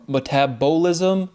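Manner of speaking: "metabolism" with a full, long "bo"; emphasized syllable. Shown as a demonstration. Speaker showing the B O inside metabolism, emphasized syllable. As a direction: slowly stress the "bo" in "metabolism". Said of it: "that's a syllable you're emphasizing".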